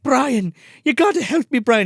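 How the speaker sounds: high-pitched begging; hammed-up appeal; mock desperate plea. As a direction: plead for help in a high, acted voice. Acting desperate plea to Brian.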